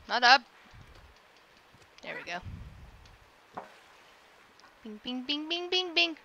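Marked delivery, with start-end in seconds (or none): 4.8-6.2 string of bings climbing in pitch; playful ascending chime imitation; rising bing noises. Player narrating the cat's moves, rising bing sounds. Makes noises while moving.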